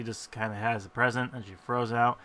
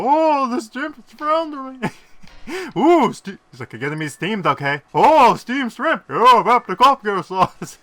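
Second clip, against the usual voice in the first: put-on much higher, louder heckling old-man voice; mock the prawn like a cranky heckler; Waldorf voice; 11 semitones higher, 12 dB louder than usual. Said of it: "Waldorf was making fun of him".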